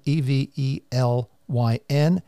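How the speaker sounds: letter by letter, slowly; spelling it out. Real, not acted. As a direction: spell each letter out clearly. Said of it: "And I'll spell that".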